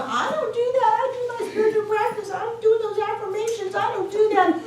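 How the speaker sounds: high squeaky mocking voice of a denier; hammed-up whiny protest; mock denial. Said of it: "even if you're going".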